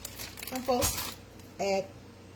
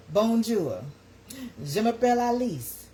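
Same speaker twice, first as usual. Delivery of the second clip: French greeting; 4 semitones higher, 4 dB louder than usual. Shown as a demonstration. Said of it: "I took French two years in high school".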